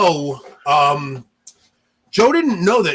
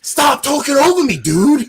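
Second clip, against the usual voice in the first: loud, high mock outburst; retold exasperated snap, pitched up; snapping; 10 semitones higher, 5 dB louder than usual. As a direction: imitate a friend losing his patience. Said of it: "Like, he snapped on me".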